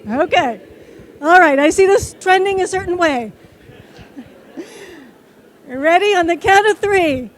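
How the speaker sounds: ordinary talk, nothing marked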